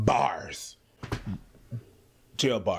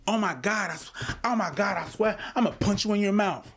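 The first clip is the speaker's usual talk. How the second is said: higher-pitched mock girl talking to her friend; lightly voiced girlfriend threatening playfully; imitating a girl friend; 8 semitones higher than usual. Mocking how girls talk to friends, high mock voice. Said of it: "girls do the same shit".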